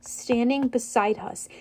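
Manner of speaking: usual speaking voice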